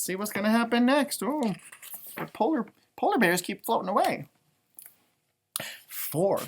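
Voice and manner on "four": soft whispered number; hushed storyteller whisper. Acted. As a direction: whisper it to build suspense. Whispered count.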